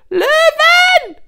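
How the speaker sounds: very high, very loud shriek; panicked scream. A narrator's reading. Hyena spotting lions behind the pack, panicked scream. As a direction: scream the alarm at the top of your voice. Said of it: "schrie Fledderohr".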